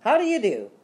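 high, loud insistent greeting. A narrator's reading. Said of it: "in a much louder voice".

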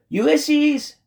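high, loud calling shout. A narrator's reading. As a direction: shout the name out at full pitch. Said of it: "she shouted".

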